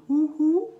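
high owl hoots. Acted. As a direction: hoot like an owl. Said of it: "an owl going".

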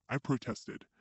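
ordinary delivery, nothing marked

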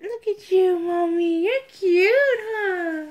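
high, loud gushing coo; baby talk. Pretend. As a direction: fuss over a baby in a high voice. Cooing to doll like a baby.

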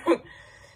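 short embarrassed burst of laughter; self-conscious laugh. Real, not acted. Girl laughing at herself mid makeup tour, embarrassed laugh. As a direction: laugh it off after feeling silly.

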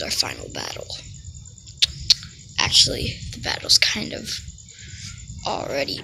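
hushed battle line; dramatic whisper. Acted. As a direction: whisper it like a showdown.

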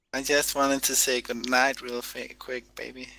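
high, slow sweet-talk; tender goodnight. Acted. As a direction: coo it like a lover. Joking goodnight over the radio.

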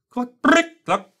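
sharp high clucking click; counter click. A narrator's reading. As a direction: imitate a Geiger counter cluck. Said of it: "reported the cosmic ray counter".

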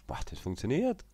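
high, loud burst of joy breaking into laughter; thrilled it finally works; delighted surprise. Real, not acted. Player cheers when the winch finally works, loud and laughing.

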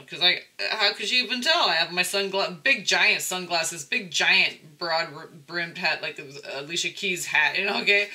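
loud, fast-rising disbelief; can't believe she could even tell; animated, laughing disbelief; excited. Real, not acted.

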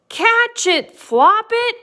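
high, loud urgent call; acted crowd's cry for help; storybook shout. A narrator's reading. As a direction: shout up to the bunny. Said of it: "pointed up towards the egg and shouted".

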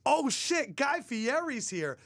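high-pitched mock excitement; imitated fan surprise, drawn out at the end; starstruck reaction. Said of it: "all react to Guy Fieri like".

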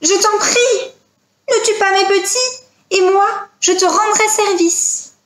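high-pitched begging voice; high, imploring animal mother; pleading mother wolf. Acted. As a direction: beg for your young ones' lives. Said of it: "le regardait d'un air suppliant".

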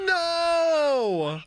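high, loud long wail; long high no, falling off; drawn-out cry of dismay. Real, not acted. Reacts to the crash.

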